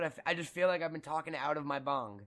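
ordinary delivery

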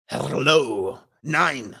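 mock German maid answering the phone; badly faked German accent. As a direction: attempt a clumsy German accent. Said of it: "I can't do a German accent".

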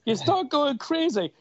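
hammed-up lockdown madness; mock crazy voice. Real, not acted. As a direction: play going stir-crazy. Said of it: "hibernation does to you".

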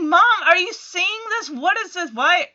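put-on a kid protesting to mom; outraged kid. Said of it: "So Jackson is like".

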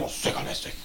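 hushed, scandalised tut-tut; whispered mock outrage. Mimicking a prudish audience member, a shocked whisper. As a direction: clutch the pearls in a whisper.

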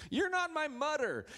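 put-on high defiant kid shout; little boy shouting at sister, high; child's defiant yell. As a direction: yell it like a bratty boy upstairs. Son shouting from upstairs.

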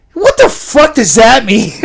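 high, loud mock-incredulous yell; retold loud disbelief. Storyteller acts out his reaction to Sue, loud disbelief. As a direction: blurt it out loudly for the laugh.

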